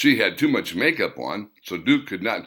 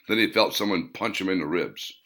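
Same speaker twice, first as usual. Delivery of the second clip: ordinary delivery, nothing marked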